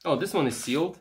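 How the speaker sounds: ordinary talk, nothing marked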